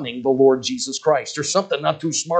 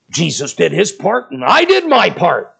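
put-on boy's voice, a bit louder; earnest child; 2 semitones higher, 5 dB louder than usual. The boy telling the preacher he did his part, earnestly. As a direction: answer proudly like a small boy.

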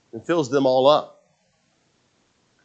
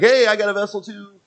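eager onlooker calling out; 11 semitones higher, 6 dB louder than usual. Acted. Preacher imitates people wanting oil too, calling out. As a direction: pipe up high to get noticed.

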